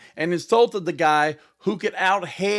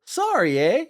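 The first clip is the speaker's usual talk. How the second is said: mock Canadian "Sorry, eh?" pitched up; Canadian accent; 4 semitones higher than usual. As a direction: play up a polite Canadian apology. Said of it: "we say".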